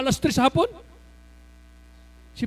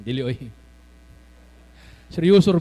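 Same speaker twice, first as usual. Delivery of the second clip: normal talking voice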